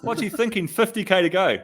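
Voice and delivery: high, mock-scolding partner's voice; mock exasperated fiancée. Imitating the fiancée scolding Pogacar on TV, high and mock-exasperated. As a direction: ham up her fed-up rant, pitched well up. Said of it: "she was just like".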